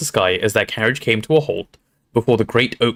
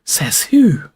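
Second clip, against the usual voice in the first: higher, put-on grumbling retort; disgruntled retort; 5 semitones higher, 3 dB louder than usual. A narrator's reading. Harry, drenched, answering the ghost, disgruntled. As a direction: toss it back sourly.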